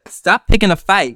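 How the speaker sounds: imitating an annoyed partner; mock exasperated. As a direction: throw the line back like a fed-up partner. Quoting a partner's complaint.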